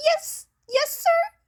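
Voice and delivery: high, loud, startled yes sir; startled yelp. Acted. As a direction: yelp it as if caught off guard. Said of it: "Izuku yelped".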